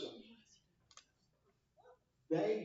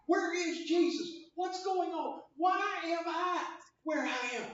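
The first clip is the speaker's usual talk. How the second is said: loud, high, panicked questions; a believer crying out in the storm; anxious cry; 11 semitones higher, 9 dB louder than usual. Retold or imitated. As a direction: call out in distress. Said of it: "we're like".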